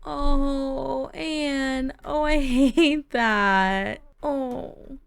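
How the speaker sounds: high, drawn-out cooing pity; pained, pitying coo; sympathetic. Real, not acted. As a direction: aww over someone's hard luck, slowly. Pitying a sad game character.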